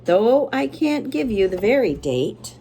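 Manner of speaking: usual speaking voice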